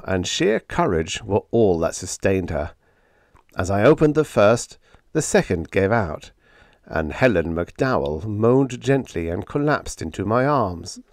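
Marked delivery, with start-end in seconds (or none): none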